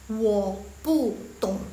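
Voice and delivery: very slow, word-by-word Mandarin; slow model reading. Shown as a demonstration. Teacher shows beginners the first phrase, slow model reading. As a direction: space out each syllable for learners. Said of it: "Coba yang pertama".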